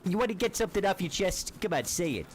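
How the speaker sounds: swaggering street thug voice; cocky nervous street slang. Acted. Tough-guy taunting a stranger.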